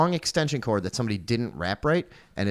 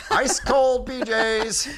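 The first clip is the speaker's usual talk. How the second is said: high, slow barker call; old-timey carnival barker; 11 semitones higher, much slower than usual. Pretend. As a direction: hawk it like a fairground barker. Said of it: "Old-timey Carnival Barker".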